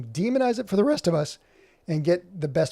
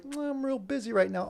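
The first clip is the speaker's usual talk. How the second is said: high-pitched imitation of someone wriggling out; mock feeble excuse; 8 semitones higher, 4 dB quieter than usual. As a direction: mimic a flaky helper begging off. Mocks helper's excuse for backing out.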